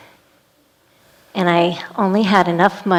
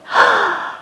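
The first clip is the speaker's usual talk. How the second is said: gasp. Retold or imitated. Caught by mother, gasps.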